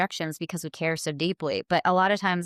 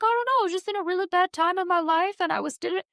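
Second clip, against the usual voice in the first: sheepish troll; 9 semitones higher, faster than usual. Retold or imitated. Quoting an apologetic troll.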